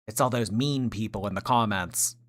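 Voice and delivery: ordinary talk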